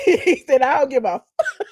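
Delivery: laughing through the quote; can't hold back the laugh while quoting. Real, not acted. Host laughing while quoting the interview. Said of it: "It's the F for me, honey".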